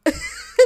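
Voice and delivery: very high shrieking laugh; a high burst of laughter; squealing laugh. Real, not acted. Laughing at the memory, squealing.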